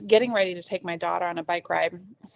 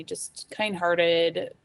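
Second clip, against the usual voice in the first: normal talking voice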